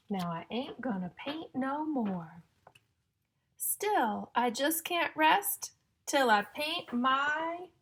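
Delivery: loud, slow, sing-song storybook reading; reading to children. Acted. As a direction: build suspense before each rhyme. Reading rhyming picture book to children.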